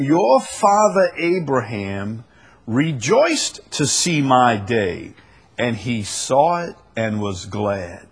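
slow, loud Bible proclamation; scripture reading. Acted. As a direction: stretch the verse out with force. Reads scripture aloud.

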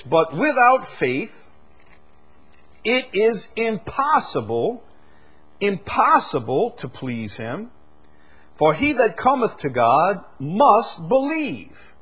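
slow, loud, soaring scripture reading; impassioned sermon reading; preaching at full force. Acted. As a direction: proclaim the verse like a revival preacher.

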